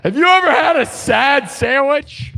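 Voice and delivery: high, yelled demand; shouted question. Acted. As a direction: yell it high as if demanding an answer. Repeats question two opposite ways.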